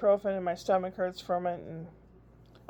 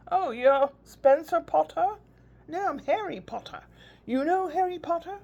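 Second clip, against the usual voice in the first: high, mock British kid's chatter; imitating grandson's put-on British voice; playful child voice; 8 semitones higher, 5 dB louder than usual. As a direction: play a small boy insisting he's Harry Potter. Dialogue with grandson in accent.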